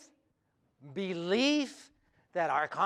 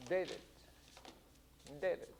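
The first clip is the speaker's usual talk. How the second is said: much softer, murmured name; imitating Edgar's soft voice; 2 semitones lower, 15 dB quieter, faster than usual. Diplomat's soft way of saying David, imitated much softer. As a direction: quietly coo his name like the diplomat. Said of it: "But he talks like this".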